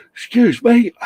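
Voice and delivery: high-pitched yawning apology; yawn-stretched words; talking through a yawn. Real, not acted. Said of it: "You're yawning".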